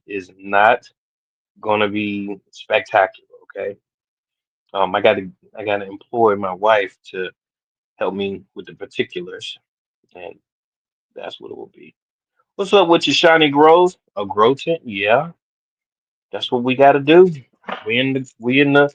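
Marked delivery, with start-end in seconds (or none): none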